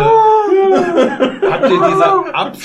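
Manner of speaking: high-pitched, helpless laughter through words; laughing loudly while talking. Real, not acted. Group cracks up over video.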